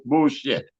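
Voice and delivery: ordinary talk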